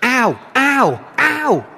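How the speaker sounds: high, hammed-up yelps of pain; mock cries of pain. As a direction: yelp "ow" like you cut your finger. Acts pain of cut fingers.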